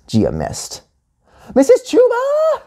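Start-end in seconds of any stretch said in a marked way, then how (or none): 1.5-2.6 put-on piercingly high child scream; very high, frantic kid screech. A narrator's reading. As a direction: scream the teacher's name. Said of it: "Gia screamed".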